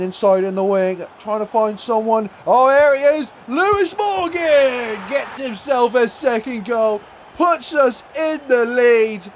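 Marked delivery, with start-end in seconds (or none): 2.5-9.3 high, drawn-out goal shout; jubilant goal call. Real, not acted. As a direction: stretch out the words in triumph. Calls the go-ahead goal live.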